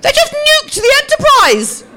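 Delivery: high, loud panicked laughing cry; mock panic. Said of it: "I'm panicking".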